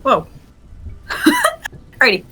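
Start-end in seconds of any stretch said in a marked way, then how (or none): 0.8-1.8 high giggle. Real, not acted. Laughs after "Whoa.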